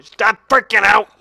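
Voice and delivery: loud, sudden outburst; exasperated shout. Pretend. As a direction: lose your cool while ordering calm. Calm advice bursts into yell.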